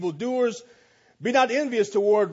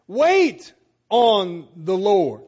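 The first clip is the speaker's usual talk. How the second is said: slow emphasis; 6 dB louder, much slower than usual. Acted. Sermon, slow emphatic line.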